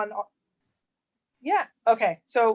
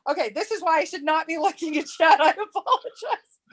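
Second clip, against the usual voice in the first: high-pitched, laughing fluster; embarrassed laughing apology; 6 semitones higher than usual. Real, not acted. Embarrassed after falling for joke.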